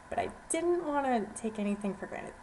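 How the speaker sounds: ordinary delivery, nothing marked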